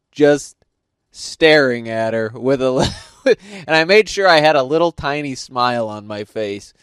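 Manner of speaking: ordinary talk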